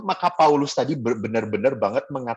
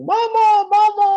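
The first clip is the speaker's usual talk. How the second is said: high, loud mock wail for mama; meniru tangis sungguh-sungguh; 7 dB louder, slower than usual. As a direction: imitate a child bawling for mother. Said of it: "Nangisnya sungguh-sungguh". (tiruan anak menangis memanggil mama, melengking)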